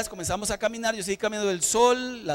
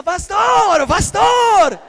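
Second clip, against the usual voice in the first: high, loud call from far above; 11 semitones higher, 9 dB louder, much slower than usual. Retold or imitated. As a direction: shout up to the pastor. Congregant calling from ride.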